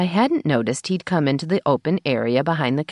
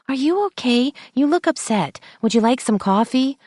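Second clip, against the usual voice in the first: high, gentle sympathetic voice; concerned receptionist; 11 semitones higher than usual. A narrator's reading.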